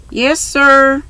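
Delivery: high, meek little answer. A narrator's reading. Daughter answering stern father from upstairs, meek. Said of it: "answered a meek voice".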